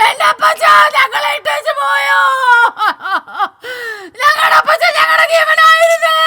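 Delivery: a loud, showy fake lament at the wake; fake mourning wail. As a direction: overact a sobbing mourner's cry of grief. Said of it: "ഒരു മയ്യമിട്ട് പേക്കൂത്ത് കാണിക്കുവാ".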